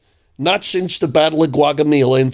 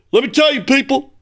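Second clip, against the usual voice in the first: high, raised pompous speechmaker; mock blowhard talk; 11 semitones higher, faster than usual. Host mimicking Obama's blowhard speech style, high and pompous. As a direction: puff it up like a blowhard orator. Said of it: "that's blowhard talk".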